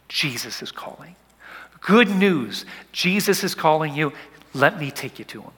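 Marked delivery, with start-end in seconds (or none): 0.1-1.2 whisper. Real, not acted.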